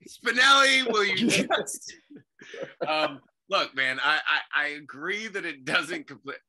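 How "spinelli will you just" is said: loud, high mock protest; exasperated outburst. Guest cuts off the host's questioning, mock exasperated yell. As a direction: yell the name, far above usual pitch.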